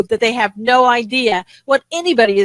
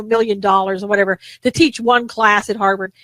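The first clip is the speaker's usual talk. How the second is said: ordinary talk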